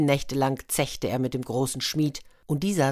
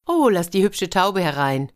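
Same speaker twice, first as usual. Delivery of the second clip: louder, higher order to her daughters; commanding; 4 semitones higher, 6 dB louder than usual. A narrator's reading. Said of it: "befahl die Drachenmutter".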